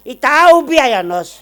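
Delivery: loud, high, stretched-out retort; comic indignation, dragged out high; exasperated old husband. Acted. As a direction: point it out loudly and slowly. Old man corrects doctor.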